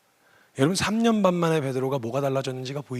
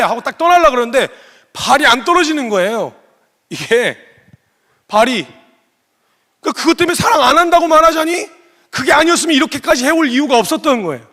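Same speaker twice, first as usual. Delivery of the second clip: much higher and louder, pleading preacher; fiery preaching; 10 semitones higher, 10 dB louder than usual. Acted. Preacher urging the congregation to face the question, fiery and loud. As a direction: drive it home to the congregation.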